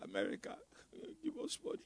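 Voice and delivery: soft, whining mock plea to World Bank; mock begging. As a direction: play a meek beggar nation, half-joking. Mocking a nation begging abroad.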